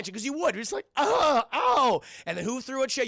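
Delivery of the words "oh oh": high, loud wailing "oh"; mock crying. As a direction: wail as if hit in the face. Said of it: "he starts crying".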